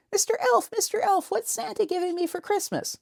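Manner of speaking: put-on very high, eager child voice; little kid voice. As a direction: pipe up like an excited kid. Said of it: "some little kid".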